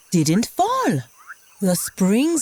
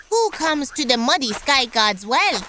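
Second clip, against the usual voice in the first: higher frog voice calling out; 6 semitones higher than usual. Acted. Frog yelling at whoever approaches the well, higher voice. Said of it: "she yelled out".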